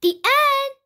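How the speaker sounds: high, loud happy finish; cheerful announcement. A narrator's reading. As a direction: close the book with a bright cheer. Cheerful end of story.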